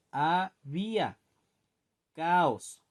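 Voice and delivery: "Había" and "caos" slow, each vowel in its own syllable; vowels held apart. Shown as a demonstration. Teacher giving hiato examples to students, vowels held apart. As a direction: split the hiatus in "Había" and "caos", drawing it out. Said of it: "en sílabas distintas".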